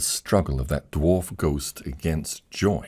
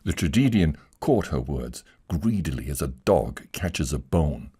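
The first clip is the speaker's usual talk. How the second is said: plain reading voice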